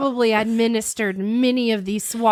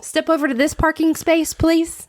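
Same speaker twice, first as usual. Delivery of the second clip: a higher, polite front-desk voice; mock receptionist; 7 semitones higher than usual. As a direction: play the prim receptionist calling him in. Mock receptionist directing him.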